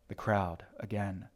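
usual speaking voice

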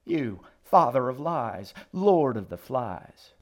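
righteous denunciation; damning accusation. A narrator's reading. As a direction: hurl each title like a curse. Old woman denounces the boy.

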